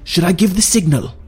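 high questioning officer voice; general asking. A narrator's reading. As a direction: ask for the order eagerly. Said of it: "said General Didier".

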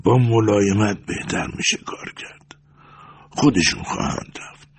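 put-on hushed, whispery old man; soft, stifled murmur; muffled, calm voice. A narrator's reading. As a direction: speak gently under your breath. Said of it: "با صدایی خفه و آرام گفت".

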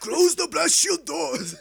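slow, loud, high hammed-up command; mock movie shout. Friend quoting a film, slow sung shout. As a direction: bellow it like a doomed commander.

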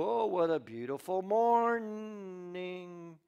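slow, drawn-out and stretching; a contented morning stretch, sung out slowly; sleepy morning stretch. Acted. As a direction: yawn it out like waking up happy. Sleepy servant stretching, yawning.

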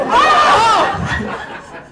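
loud, very high comic shriek; mock scream. Grandchild hit by grandfather's brutal joke, in mock scream. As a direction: let out a hammed-up scream of outrage.

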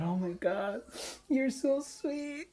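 very high, tearful squeal, imitated; mock weeping from being touched; imitated happy crying. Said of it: "she started to cry even more".

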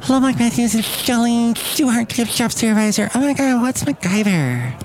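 high, put-on caller voice; Jolene, gift shop supervisor. Gift shop supervisor calls the host, high character voice.

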